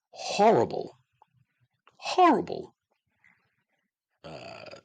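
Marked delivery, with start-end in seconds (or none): 0.1-2.7 horrible, horrible squeaked way up high; mock outrage. Real, not acted. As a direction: squeal the word in disgust, jumping higher the second time. Ranting about terrible song lyrics.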